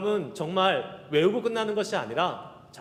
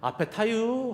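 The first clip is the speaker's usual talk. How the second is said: Chungcheong grandmother; 7 semitones higher, slower than usual. Retold or imitated. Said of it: "앞에 있는 할머니가".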